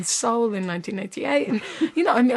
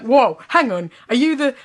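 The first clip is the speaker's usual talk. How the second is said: louder, startled stammer; 4 semitones higher, 8 dB louder than usual. Acted. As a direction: caught off guard. Businessman startled by cat.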